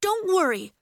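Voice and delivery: high, loud encouragement; urgent reassurance. A narrator's reading. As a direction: reassure over the panic. Girl urgently reassures frightened friend.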